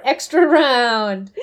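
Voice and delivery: slow, drawn-out game title call; on-screen announcement. Retold or imitated. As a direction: stretch it out like a round announcement. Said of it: "That's what it says across the screen".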